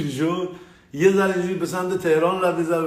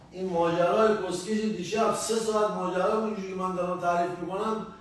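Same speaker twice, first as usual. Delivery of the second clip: normal talking voice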